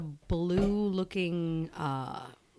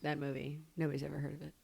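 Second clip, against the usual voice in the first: quiet, lower, dead serious joke; deadpan; 4 semitones lower, 11 dB quieter, faster than usual. Acted. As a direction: sell the joke with a straight face. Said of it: "Very serious face ... deadpan there".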